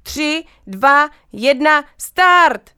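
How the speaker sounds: countdown building to a loud high start; excited countdown. A narrator's reading. Boy counting down the swimming race with mum, building to a loud shout. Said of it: "rovnou to odpočítal".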